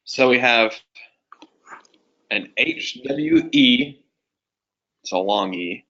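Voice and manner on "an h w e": "an H W E" sounded out slowly and high; spelled-out sounds. Shown as a demonstration. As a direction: sound out "an H W E" letter by letter. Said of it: "So it's an H sound".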